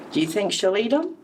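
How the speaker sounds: ordinary delivery, nothing marked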